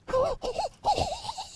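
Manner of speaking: high hooting laugh. Real, not acted. Streamer cracking up at their own joke, high laugh. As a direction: let out thin, high hoots of laughter.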